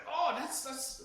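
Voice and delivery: a clumsy stab at a British voice, up high; bad British accent. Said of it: "my British accent is really bad".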